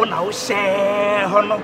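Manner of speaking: low, slow spell chanting; intoned incantation. Acted. Juju man recites a spell.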